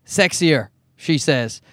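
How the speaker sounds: ordinary talk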